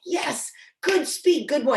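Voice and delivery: put-on "Yes, good speak, good boy." high and slow; praising the puppy. Shown as a demonstration. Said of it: "I'm like".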